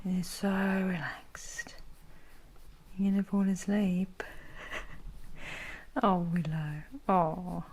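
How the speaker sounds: soft unvoiced whisper, slow. Real, not acted. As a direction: lull the dog to sleep in a whisper. Whispering to sleepy dog.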